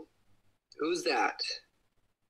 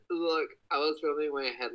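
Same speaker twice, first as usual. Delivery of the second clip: normal talking voice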